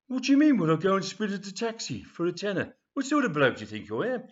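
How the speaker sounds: loud, high-pitched offended grandad; acted affronted old man; indignant outburst. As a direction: explode at an outrageous request. Grandad mishears a request, takes offence.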